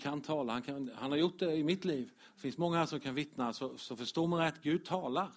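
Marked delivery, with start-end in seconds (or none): none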